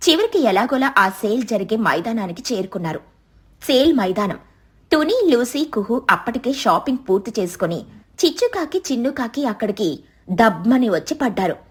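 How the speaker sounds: ordinary delivery, nothing marked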